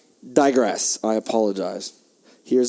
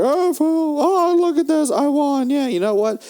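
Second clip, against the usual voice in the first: high, far-off taunting gloat; mock gloating rival jockey, much higher; 13 semitones higher, slower than usual. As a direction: squeal it like a smug cheater. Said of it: "that guy's like".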